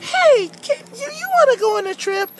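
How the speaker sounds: high-pitched, worked-up character voice; complaining cartoon character; rowdy aggrieved Francis. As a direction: tattle indignantly in a high puppet voice. Francis tells how she was tripped.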